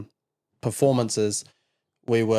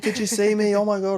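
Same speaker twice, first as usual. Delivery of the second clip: imitating an excited child, much higher pitch; super excited; 10 semitones higher than usual. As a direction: jump up high, bursting to be noticed. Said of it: "She was super excited".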